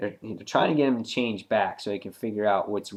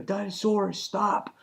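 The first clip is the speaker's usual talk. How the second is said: retold plea, pitched way up; urgent plea; 11 semitones higher, slower than usual. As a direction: imitate a desperate call to stop. Mark pleads to stop battle.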